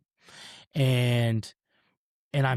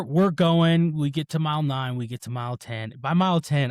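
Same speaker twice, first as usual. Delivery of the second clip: ordinary delivery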